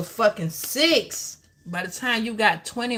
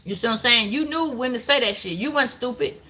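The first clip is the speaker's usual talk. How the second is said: ordinary talk, nothing marked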